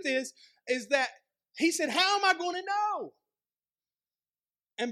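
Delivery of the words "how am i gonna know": high-pitched, disbelieving outburst; hammed-up incredulous question; incredulous doubt. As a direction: exclaim it in acted disbelief, voice shooting up. Zechariah's doubt, exclaimed retake.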